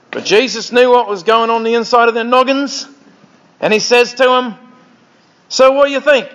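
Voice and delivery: put-on Australian storyteller; Aussie accent. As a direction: tell it in a hammed-up Aussie accent. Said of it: "here's from the Aussie Bible".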